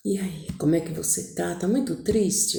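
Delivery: hushed, breathy question close to her; tender whisper. Retold or imitated. Husband gently asking his sad wife, whispered. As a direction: whisper it softly into her ear.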